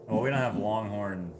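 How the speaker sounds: normal talking voice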